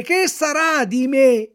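loud, slow drawn-out lament on me; slow despairing wail. A narrator's reading. Puppet bewailing his fate, slow drawn-out wail. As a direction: stretch it out in misery. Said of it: "continuava a piangere, a berciare".

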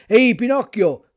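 put-on the biggest boy yells right in Pinocchio's face; loud challenging call; shouted. A narrator's reading. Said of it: "gridò allora il più grande".

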